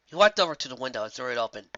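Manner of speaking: plain reading voice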